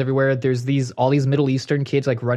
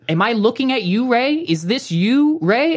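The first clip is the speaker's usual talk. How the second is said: raised-pitch, mock-incredulous questioning; exasperated questioning; 8 semitones higher than usual. Caller eyeing the man behind glass, exasperated questioning. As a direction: press for an answer, voice climbing.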